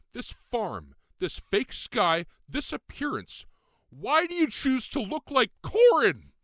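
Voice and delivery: shouting high and strained, close to crying; near tears, loud. Acted. Ren demanding why Lucian looks like Corin, tearful shout. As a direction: voice climbing into a tearful cry. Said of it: "I was near tears".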